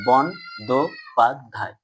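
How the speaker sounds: "বন, দো, পাদ, ধাই" in distinct beats; syllable by syllable. Shown as a demonstration. Teacher recapping the syllable analysis, "বন, দো, পাদ, ধাই" each separate. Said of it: "দল বিশ্লেষণ করে কি কি পেলাম".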